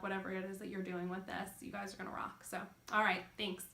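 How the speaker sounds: ordinary talk, nothing marked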